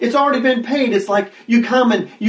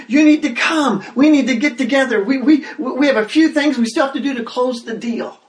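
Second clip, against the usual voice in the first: excited preacher calling people in; urgent invitation; 6 semitones higher than usual. Shown as a demonstration. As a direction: plead with them to come now. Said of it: "I would translate it this way".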